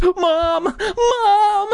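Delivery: high wailing calls; frightened begging. Acted. Brother calling for his mother, panicked wail. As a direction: cry out high for help. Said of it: "Noah begged".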